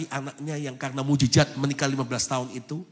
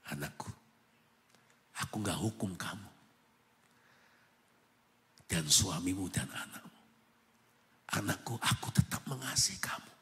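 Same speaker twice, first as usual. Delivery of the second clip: much lower and softer, down to a whisper; tender voice of God; 5 semitones lower, 6 dB quieter than usual. Retold or imitated. God answering the weeping mother, in a low tender hush. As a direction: comfort her gently, like a hushed father.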